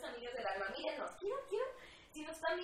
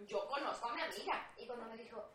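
usual speaking voice

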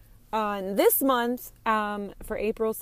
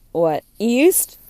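sudden loud outburst of disbelief; mock surprise; 2 semitones higher, 13 dB louder than usual. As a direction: blurt it out like a startled joke. Joking about going to PAX East.

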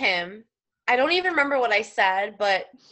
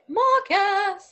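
calling out; 8 semitones higher than usual. Retold or imitated. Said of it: "we always used to be like".

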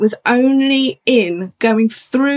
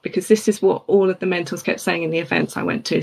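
ordinary talk, nothing marked

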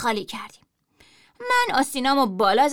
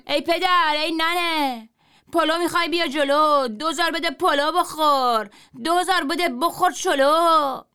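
higher, louder slow street cry; sing-song selling call; hawker shout; 5 semitones higher, 3 dB louder, slower than usual. A narrator's reading. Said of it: "من داد زدم".

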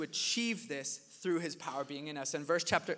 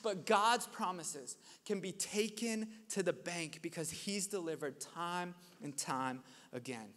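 usual speaking voice

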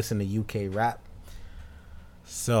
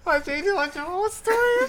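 high, laughing reply; 5 dB louder than usual. Real, not acted. Answering his co-host while cracking up. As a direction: laugh the words out.

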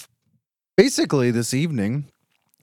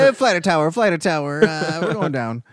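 mock pilot mayday; 6 semitones higher, 4 dB louder than usual.

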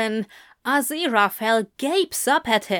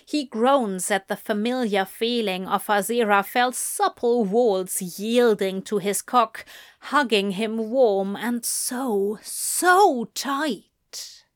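ordinary delivery

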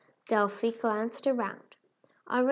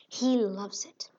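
whispering; slower than usual. A narrator's reading. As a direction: whisper it close to the ear. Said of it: "whispered back".

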